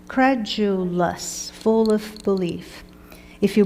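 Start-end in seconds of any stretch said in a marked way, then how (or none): 0.1-1.5 drawn out. Shown as a demonstration. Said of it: "teacher drawing out vocabulary word".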